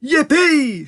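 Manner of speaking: high, whooping cheer; high-pitched squeal of a cheer; gleeful shout. A narrator's reading. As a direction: whoop for joy. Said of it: "shouted Grandpa Joe".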